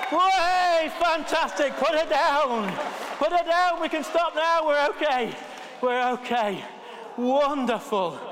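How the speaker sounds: loud, high whoop of relief; triumphant shout. Real, not acted. Vicar celebrating the lights coming on, loud triumphant cheer. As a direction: celebrate like you've just won.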